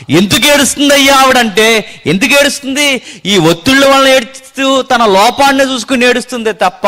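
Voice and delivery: high, loud sermon voice, driving each line home; fiery preaching. Acted. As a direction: preach hard to the congregation. Preaching at full force.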